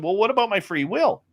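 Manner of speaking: defending his free will, pitched right up; high indignant protest. Retold or imitated. Arguing with Pascal about free will, high and indignant.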